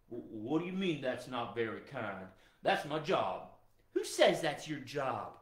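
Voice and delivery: low, protesting dog character; puzzled hound dog. Pretend. Guard dog protesting to his owner, low puzzled hound.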